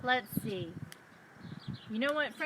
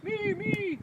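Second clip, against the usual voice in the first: red cow voice; 8 semitones higher than usual. A narrator's reading. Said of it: "says the red cow".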